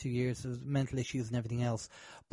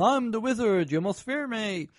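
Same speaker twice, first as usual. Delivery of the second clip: raised, higher mock-booming wizard voice; 7 semitones higher than usual. Wizard at the end demanding fear, in a booming voice. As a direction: put on a booming wizard proclamation. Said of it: "with this booming voice".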